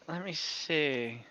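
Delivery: ordinary talk, nothing marked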